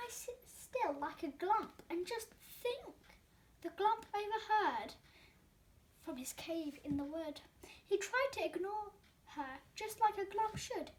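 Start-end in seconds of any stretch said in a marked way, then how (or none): none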